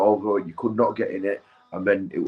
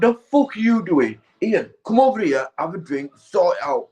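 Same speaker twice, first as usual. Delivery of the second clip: put-on older mate Daz; 7 semitones higher than usual. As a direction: call them over like a bossy older lad. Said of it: "he's like".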